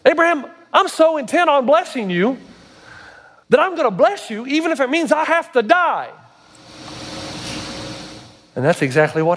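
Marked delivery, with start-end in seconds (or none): 0.0-6.1 high-pitched, emphatic preacher delivery; impassioned preaching. Real, not acted. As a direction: drive the message home with fervor. Preaches at rising intensity.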